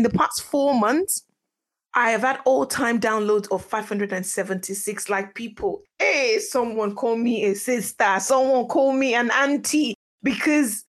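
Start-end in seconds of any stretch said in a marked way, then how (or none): none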